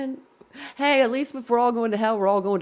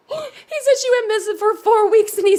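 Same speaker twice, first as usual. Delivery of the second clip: laughing retelling; 11 semitones higher, 7 dB louder than usual. Real, not acted. Retelling the clip, cracking up.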